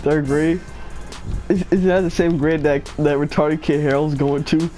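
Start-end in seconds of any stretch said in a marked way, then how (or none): none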